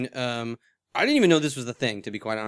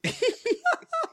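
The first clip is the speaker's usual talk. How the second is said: squeaky high-pitched giggle. Real, not acted. High laugh.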